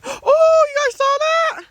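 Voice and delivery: gasping, high and loud; startled by the gust of snow; surprised gasp. Real, not acted.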